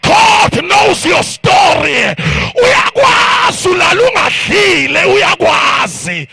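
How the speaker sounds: high, loud shouted call, slow and stretched; climactic preaching cry. Acted. Preacher rallying the crowd to rise, climactic shout. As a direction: cry it out at the peak of the sermon.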